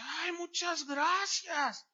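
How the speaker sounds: high, drawn-out imitation of a grateful girl; acted-out grateful exclamation; effusive thanks. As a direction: gush it slowly in a high voice. Young woman thanks him for wallet.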